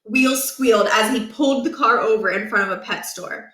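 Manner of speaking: ordinary delivery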